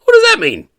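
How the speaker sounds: high, loud, baffled indignation; squeaky outraged question; indignant. Retold or imitated. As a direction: demand it, offended and clueless. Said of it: "so indignant".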